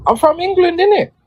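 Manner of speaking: high, put-on London slang; patois slip. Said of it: "Bit of the patois came out".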